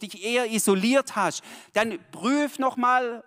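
loud, high-pitched sermon delivery; fervent preacher voice; impassioned preaching. Real, not acted. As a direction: preach it out to the congregation. Preaching at full force.

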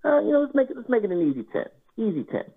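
high-pitched, playfully innocent table talk; feigned casual. Retold or imitated. Player coaxing the table into a small bet, feigned casual. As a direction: toss out the bet in a high, harmless voice.

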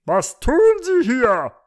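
loud, high, drawn-out demand; officious high rooster; stern rooster policeman. A narrator's reading. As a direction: challenge a loiterer as a rooster cop. Rooster policeman challenges the sleeper.

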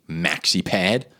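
ordinary talk

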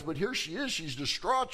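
ordinary talk, nothing marked

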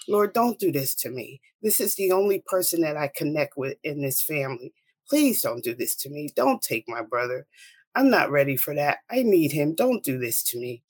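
usual speaking voice

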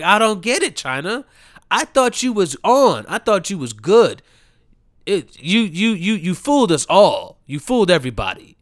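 loud, high, disbelieving cry; yelling your disbelief at a fallen star; exasperated outburst. Real, not acted. Ranting at China, loud and exasperated.

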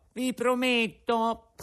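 high, slow sobbing puppet voice; sobbing promise. Acted. Pinocchio promising Geppetto through sobs, high slow sobbing. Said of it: "disse il burattino singhiozzando".

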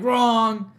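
a loud, high rejection; loud wrong. Retold or imitated. Professor rejecting a student's essay, loud. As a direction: shout it down as the stern professor.

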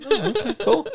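high, bright put-on voice. Mocking the boss's [name] question, high goofy voice. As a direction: play a clueless guy trying too hard.

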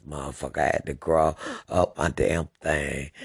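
ordinary talk

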